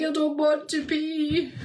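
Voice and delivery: high, slow whimpering plea; mock crying. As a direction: whine it out like a tearful new mum. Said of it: "I'd literally sit crying".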